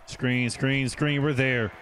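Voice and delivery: loud, rising urgency; urgent call-out. Real, not acted. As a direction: shout instructions as the play unfolds. Calls out live play.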